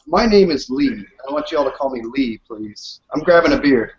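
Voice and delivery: ordinary talk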